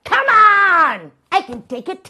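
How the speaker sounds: high, loud, urging yell; goading shout. Acted. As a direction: egg her on at full volume. Guest goads host to punch.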